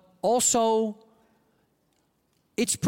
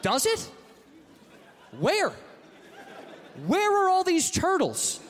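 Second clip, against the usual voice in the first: pitched way up in disbelief; incredulous high yelp; 10 semitones higher than usual. Acted. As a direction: demand it, baffled. Comic incredulous rant.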